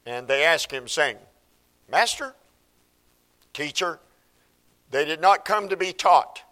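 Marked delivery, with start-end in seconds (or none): 1.9-4.0 sarcastic. Retold or imitated. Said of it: "in a sarcastic way".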